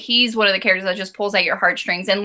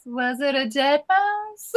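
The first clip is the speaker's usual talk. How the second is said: slow, higher, mock-horrified "Was it a dead mouse?"; a hint of a shocked aunt asking about the mouse; lightly voiced incredulous aunt; 4 semitones higher, 4 dB quieter, slower than usual. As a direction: drag out "Was it a dead mouse?" in mild disbelief. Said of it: "You just picture her face".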